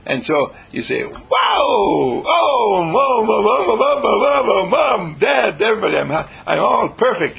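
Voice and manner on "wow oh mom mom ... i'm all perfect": loud, high, breathless calling for Mom; thrilled shout. Acted. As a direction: burst out with relief and joy. Patient thrilled at good news.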